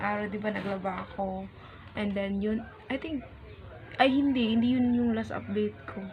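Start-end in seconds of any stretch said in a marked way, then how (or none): none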